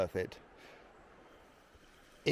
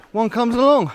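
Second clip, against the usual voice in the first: excited; 8 semitones higher, 10 dB louder than usual. Real, not acted. Finds trail sign after doubt.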